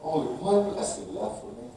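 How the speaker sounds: soft, hushed broken plea; pleading. Acted. Esau pleading softly with his father, broken. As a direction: plead quietly, almost spent. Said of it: "Esau pleaded".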